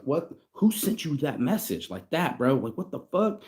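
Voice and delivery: a friend's alarmed what-the-hell, voiced high; shocked friend. Turkish friend reacts to German recording.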